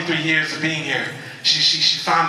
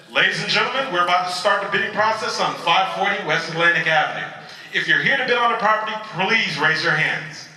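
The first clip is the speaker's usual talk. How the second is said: auctioneer. Acted. Auctioneer opening bidding on a property, formal announcement. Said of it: "the auctioneer came up to the podium".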